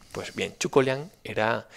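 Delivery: usual speaking voice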